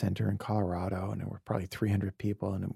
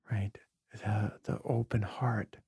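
hushed whisper; 7 dB quieter than usual. Real, not acted. Guest hushed as he tells host of the open heart, whispered. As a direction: let it fall to a breathy hush, moved.

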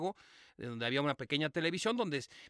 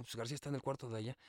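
hushed talk; 5 semitones lower, 11 dB quieter, faster than usual. Retold or imitated. Whispering so others don't wake.

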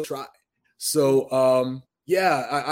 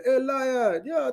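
a high, quick Jamaican-style greeting; playful imitation of a Jamaican radio skit; 11 semitones higher, much slower than usual. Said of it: "somebody was talking to [name]".

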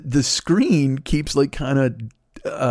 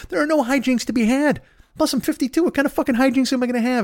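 much higher, incredulous complaint; exasperated rant; 11 semitones higher than usual. Real, not acted. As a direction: squeak it out in disbelief. Ranting about being fifty-two.

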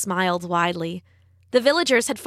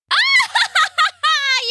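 very high, loud gloating cackle; wicked high witch laugh; witch's cackle; 5 dB louder than usual. A narrator's reading. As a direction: cackle shrilly in triumph. Witch gloats over trapped Rapunzel.

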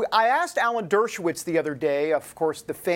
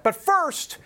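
sports announcer; 10 semitones higher than usual. Pretend. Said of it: "I feel like the N F L".

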